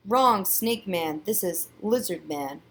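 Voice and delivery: ordinary delivery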